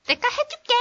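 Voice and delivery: high, cute mock 내가 해줄게; chirpy high-pitched teacher impression; imitating Dana teacher. Imitating a cute teacher volunteering, pitched high. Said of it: "막 이러면서".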